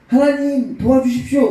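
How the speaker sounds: high, loud cry to heaven; calling out. Retold or imitated. Farmer crying out to God at dawn, loud high plea.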